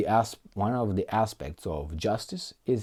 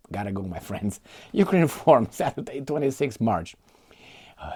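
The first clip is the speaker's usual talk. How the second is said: ordinary talk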